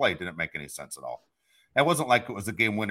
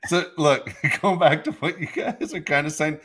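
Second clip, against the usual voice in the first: laughing agreement; 7 semitones higher, 8 dB louder than usual. Real, not acted. Laughing, then loudly agreeing.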